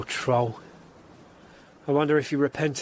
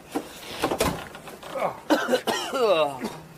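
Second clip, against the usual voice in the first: gagging cough from the stink; choking cough; 6 semitones higher than usual. Acted. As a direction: cough on the foul air. Choking on the stench inside.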